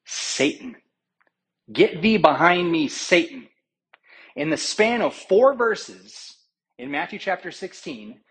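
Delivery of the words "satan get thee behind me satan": loud, stern rebuke, raised and forceful; a forceful shout of rebuke. Acted. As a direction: cast him back with full weight. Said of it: "What does he say?".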